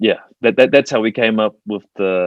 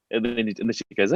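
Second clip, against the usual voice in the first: usual speaking voice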